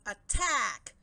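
loud, ringing attack shout; battle cry. Acted. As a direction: yell the charge.

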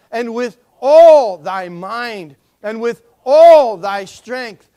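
slow, loud, ringing recitation of the verse; solemn scripture reading. Shown as a demonstration. Pastor leads the congregation in a slow loud reading. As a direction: intone each line, leaning on all. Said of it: "will you read this with me".

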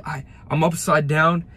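usual speaking voice

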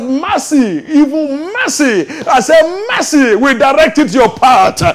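high, ringing prophetic declaration; preach at full force. Real, not acted. Preacher declaring mercy over the congregation, high forceful proclamation.

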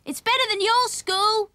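high, loud storybook shout; shouting after them. A narrator's reading. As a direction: call out boastfully to children walking away. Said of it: "he shouted after them".

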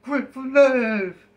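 high, loud, odd character voice; goofy NPC voice. Retold or imitated. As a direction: ham up a dim game character. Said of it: "He's like".

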